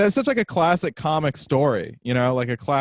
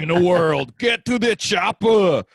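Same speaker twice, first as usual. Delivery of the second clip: hammy movie-trailer intro into loud, drawn-out action-hero shout; impression comic; 4 semitones higher, 4 dB louder than usual. Podcaster mocking a comic's repeated jokes, hammy impressions. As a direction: imitate a comic's tired impressions, punching the catchphrase. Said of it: "the same three impression jokes".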